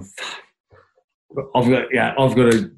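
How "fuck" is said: a low, dropped-voice curse under the breath; muttered curse. Retold or imitated. Player seeing the tough opponent come on, muttering quietly. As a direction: sink the word like quiet dread.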